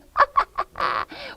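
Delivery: high, gleeful cackle; witch's cackle. Acted. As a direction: cackle at your prey. Witch laughs while luring Hansel.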